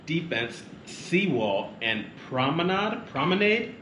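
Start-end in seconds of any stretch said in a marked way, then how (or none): 2.3-3.1 PROMENADE ending in "nahd", drawn out questioningly. Shown as a demonstration. Host trying out pronunciations aloud, promenade with AH. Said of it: "Is it promenade or promenade". 3.1-3.8 PROMENADE ending in "nade", pitched up as a question. Shown as a demonstration. Host trying out pronunciations aloud, promenade with AY. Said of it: "Is it promenade or promenade".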